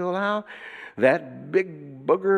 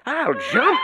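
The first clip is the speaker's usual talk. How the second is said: boasting rabbit; 9 semitones higher than usual. A narrator's reading. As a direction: pipe it up proudly.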